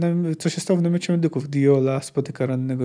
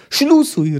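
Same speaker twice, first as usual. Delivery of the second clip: high-pitched barked order; commander's roar; 10 semitones higher, 5 dB louder than usual. A narrator's reading. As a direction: call the troops to close ranks, much higher than usual. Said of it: "ryknął Brenibor". (ryk dowódcy)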